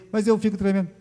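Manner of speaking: high, anxious self-quote; pleading complaint. Retold or imitated. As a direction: plead your trembling like a worried patient. Quoting own complaint to pastor.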